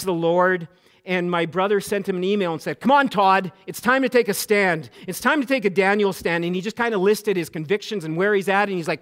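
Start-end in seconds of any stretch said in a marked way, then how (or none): 2.8-6.4 worked up. Retold or imitated. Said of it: "he was pretty worked up".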